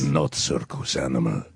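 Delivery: a gruff Russian tiger laying down the law; Russian tiger accent. Acted. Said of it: "I play the Russian tiger".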